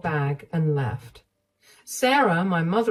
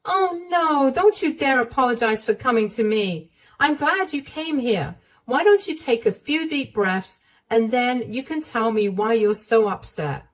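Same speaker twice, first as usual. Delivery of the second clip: put-on higher, concerned older woman's voice; higher, gently alarmed motherly voice; caring mother-in-law; 8 semitones higher than usual. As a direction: comfort her like a worried mom. Narrator voicing the mother-in-law.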